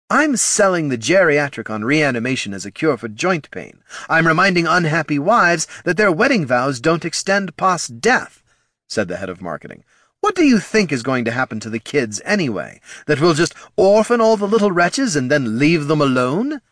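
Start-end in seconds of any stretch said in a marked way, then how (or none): 0.1-8.4 high, punchy salesman pitch; head of marketing. Acted. Head of marketing justifies his undead sales pitch, emphatic. 10.2-16.6 indignant, high and loud rhetorical questions; head of marketing. Acted. Head of marketing challenges Tyron about the kids, indignant.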